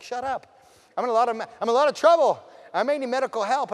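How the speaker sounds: high, loud protest; exasperated outburst. Acted. Acted exasperated reply to friend.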